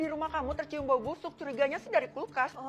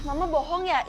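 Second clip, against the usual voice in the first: ordinary delivery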